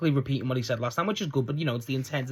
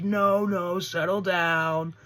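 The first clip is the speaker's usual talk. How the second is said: higher-pitched, drawn-out mock calming; calming a crowd; 6 semitones higher, much slower than usual. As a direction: stretch it out slowly, pitched up, as if hushing a crowd. Quoting wrestler calming screaming crowd.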